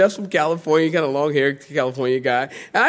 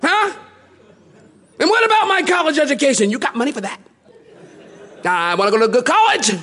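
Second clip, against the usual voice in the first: put-on arguing baby; 12 semitones higher, faster than usual. Imagined newborn argues with mom, high whiny protest. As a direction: whine like a demanding baby. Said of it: "Not like".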